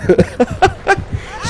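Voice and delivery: high, breathless laugh; high laughter. Real, not acted. Player who ran into the killer, helpless laughing. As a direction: laugh helplessly at your own blunder.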